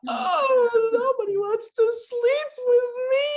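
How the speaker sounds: put-on high, loud, crying whine of a little girl; dramatic whining child. Mother imitating her daughter's bedtime drama, high crying whine. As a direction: wail it like a seven-year-old in full drama. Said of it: "look at all this drama".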